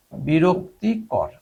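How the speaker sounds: বিরক্তিকর stretched out slowly and clearly; slow dictation. Shown as a demonstration. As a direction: say বিরক্তিকর slowly so students can write it. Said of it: "annoying হচ্ছে বিরক্তিকর". (ধীরে স্পষ্ট করে বলা)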